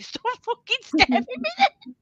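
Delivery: high, loud mock shriek at her partner; exasperated shriek. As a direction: shriek it at him, fed up. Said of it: "I'm like".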